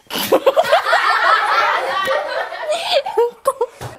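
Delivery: loud, high laughing burst; burst of laughter. Real, not acted. Interviewee bursting out laughing at the carrots. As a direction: crack up at the rabbit story.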